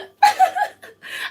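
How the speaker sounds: high bursting laugh at herself; laughing at her own attempt; burst of laughter. Real, not acted. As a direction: break up laughing.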